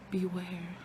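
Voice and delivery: soft ghostly beware; ghostly warning. Retold or imitated. A dead daughter's ghost warning her father, softly. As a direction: breathe it like a ghost. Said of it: "sees her off side of the crypt".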